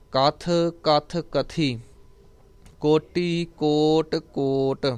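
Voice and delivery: ਕਥ said slowly and light, not heavy; ਕਥ ਪੋਲਾ ਉਚਾਰਨ; soft unaspirated kath. Shown as a demonstration. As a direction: keep ਕਥ ਕਥ ਕਥੀ soft and gentle. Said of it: "ਕੱਥ ਭਾਰਾ ਨਹੀਂ ਕਰਨਾ, ਇਹ ਪੋਲੇ ਨੇ".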